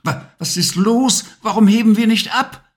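stammering, high and rushed; a scared child stuttering out the question; frightened boy. A narrator's reading. Levi asks the witch why they won't lift off, stammering in fear. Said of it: "Ängstlich blickte Levi".

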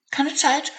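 very high squeaky mouse voice; squeaking mouse. Acted. Hazel mouse brushes off the dormouse, squeaking. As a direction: squeak it out in a hurry. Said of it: "fiepte die kleine Maus".

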